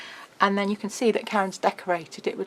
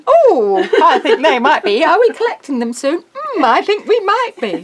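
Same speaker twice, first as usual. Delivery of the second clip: high, loud sing-song mock secrecy; coy teasing; 9 semitones higher, 10 dB louder than usual. Host teasing viewers about new stock, sing-song coy. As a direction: tease them like you know a secret.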